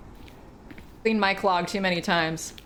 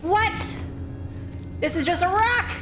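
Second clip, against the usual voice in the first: high-pitched disbelief, rising at the end; incredulous outburst; 10 semitones higher than usual. Real, not acted. Streamer finds only a rock, incredulous outburst. Outraged at the game.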